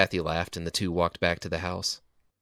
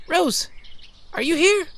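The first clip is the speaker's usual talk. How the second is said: much higher, louder call from another room; Kathy shouting for her friend from inside; 7 dB louder, slower than usual. Acted. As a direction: call out for Rose through the house. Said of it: "Kathy's voice shouting from inside".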